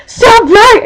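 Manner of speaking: high, sharp mock yell; imitated sudden outburst. Friend scolds the too-bright sun, sudden mock outburst. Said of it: "Michelle all of a sudden just goes".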